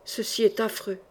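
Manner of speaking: murmuring in dismay; murmured. Acted. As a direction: breathe it out, stunned. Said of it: "Il murmura".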